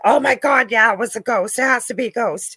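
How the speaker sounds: mock gasp of excitement; gushing believer. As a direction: ham up an overeager ghost hunter. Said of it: "they're not gonna say".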